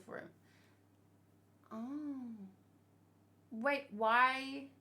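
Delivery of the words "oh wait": ordinary talk, nothing marked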